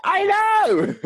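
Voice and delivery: high, loud delighted shriek; excited squeal. Retold or imitated. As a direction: squeal in agreement. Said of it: "I was like".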